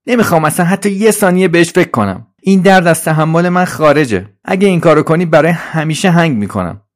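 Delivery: high, loud, panicky protest; Trolley cutting in. Acted. Trolley interrupts Suzi, dreading a crash, high protest. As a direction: jump in high and forceful, refusing. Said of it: "سریع حرفش رو قطع کرد".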